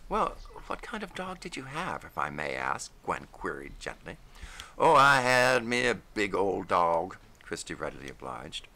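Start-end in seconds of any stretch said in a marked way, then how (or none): 0.1-2.9 higher voice; Gwen. A narrator's reading. Gwen gently asking Christy about his dog, higher voice. 4.8-7.2 louder, folksy drawling storyteller voice; Christy O'Keefe. A narrator's reading. Christy telling Gwen over dishes about dogs, louder folksy voice.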